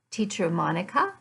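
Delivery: ordinary talk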